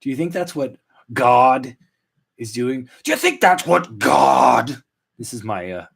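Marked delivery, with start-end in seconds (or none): 3.0-4.8 slow, put-on movie devil rant; Al Pacino. Host musing on God and fishing, hammed-up Pacino impression. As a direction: drag it out like a ranting screen devil. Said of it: "Al Pacino and Devil's Advocate impression".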